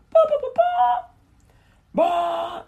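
high, loud ba ba like a horn blast; mock megaphone blasts; blowhorn imitation. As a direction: blare it out like a blowhorn.

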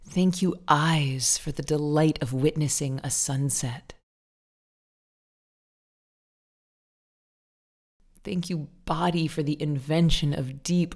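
savoring, unhurried thanks with lingering key words; guided gratitude affirmations. Acted. Meditation teacher leading listeners in thanking the body, guided gratitude affirmations. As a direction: lead a repeat-after-me affirmation, drawing out the ends. Leads spoken affirmations to repeat.

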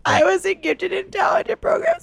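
high, crying self-pity; whiny wail. Acted. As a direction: wail it like a drunk. Drunk teacher wails self-pity.

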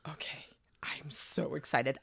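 excited whisper. Real, not acted. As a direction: whisper it with glee. Excited aside.

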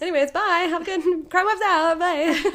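high, loud farewell breaking into laughter; mock sign-off. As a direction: pretend to wrap up the show, laughing. Joke sign-off mid show.

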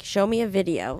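normal talking voice

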